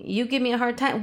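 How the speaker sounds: ordinary talk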